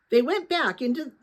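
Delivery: usual speaking voice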